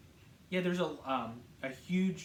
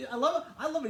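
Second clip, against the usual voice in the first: ordinary talk, nothing marked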